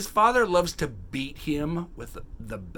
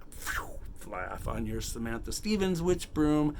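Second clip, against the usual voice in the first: ordinary delivery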